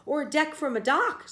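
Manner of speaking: high, loud gleeful yell; mock scream. A narrator's reading. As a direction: shriek it, caught up in the game. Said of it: "screamed Stewart".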